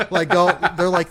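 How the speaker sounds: ordinary talk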